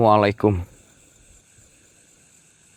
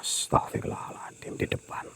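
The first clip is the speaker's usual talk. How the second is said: higher, hushed whisper; frightened whisper; 10 semitones higher, 18 dB quieter than usual. Real, not acted. Ghost hunter whispering that something is in front of him. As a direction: barely breathe it, it's right there.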